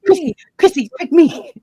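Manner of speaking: put-on high, pleading kid voice; eager pick me. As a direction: beg like a kid waving a hand. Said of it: "I'm like".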